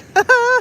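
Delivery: high burst of laughter; amused laugh. Real, not acted. Joking about having an Irish boyfriend, high amused laugh. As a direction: laugh at your own story. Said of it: "Killing myself laughing".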